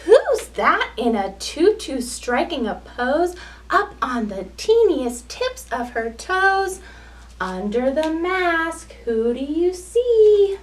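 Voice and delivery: put-on lively sing-song storytelling for small children; picture-book read-aloud. A narrator's reading.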